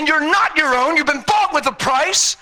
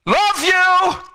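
the Son calling out; 6 semitones higher than usual. Retold or imitated. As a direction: call out love over the noise. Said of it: "here's the son".

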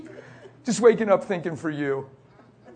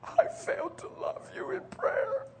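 very high, soft, crying wife's voice; tearful wife imitation; 10 dB quieter than usual. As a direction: pitch it way up like she's breaking into tears. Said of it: "She said".

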